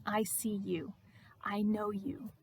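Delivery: soft, intimate reassurance; tender assurance. Acted. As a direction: speak quietly to someone you know fully. Jesus's words to Mary, softer.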